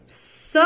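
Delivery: "sólidas" with SÓ set apart, then li-das falling; stress on first syllable. Shown as a demonstration. Teacher showing the same rule, sólidas split after SÓ. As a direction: hit SÓ, pause, drop li-das. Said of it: "Mesma coisa".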